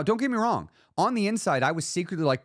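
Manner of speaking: ordinary delivery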